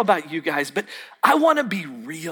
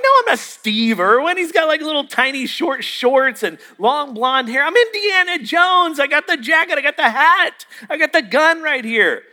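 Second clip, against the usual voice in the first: higher-pitched indignant listing; exasperated protest; 7 semitones higher than usual. Retold or imitated. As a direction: protest your costume is obvious, voice climbing. Retold protest at wrong guess.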